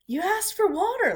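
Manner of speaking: ordinary delivery, nothing marked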